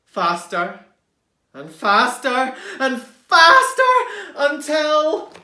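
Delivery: growing excitement; building. Acted. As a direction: build it up with the fall. Building tension while falling.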